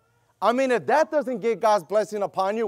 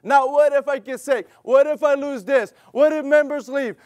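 usual speaking voice

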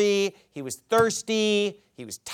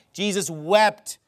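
normal talking voice

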